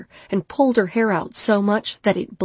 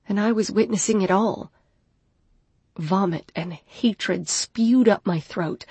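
ordinary delivery, nothing marked

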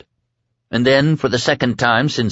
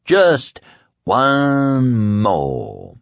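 a crazed punk begging for more; wild kid voice; 2 semitones higher, much slower than usual. A narrator's reading.

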